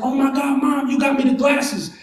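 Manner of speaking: high-pitched, excited gratitude; thrilled kid. Retold or imitated. Son seeing mom pull out glasses, high delighted shout. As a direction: squeal it like an overjoyed child.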